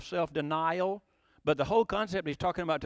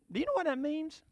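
much higher, softer curious question; asking innocently; 9 semitones higher, 5 dB quieter than usual. Shown as a demonstration. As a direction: ask it lightly, curious. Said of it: "just say".

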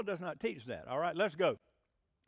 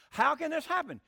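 incredulous question; 7 semitones higher, 6 dB louder than usual. Acted. Reads Nicodemus's question in disbelief.

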